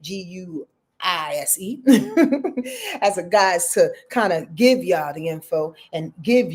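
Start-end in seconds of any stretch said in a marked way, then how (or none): 1.8-3.0 high-pitched laugh at oneself; real amused high laughter. Real, not acted.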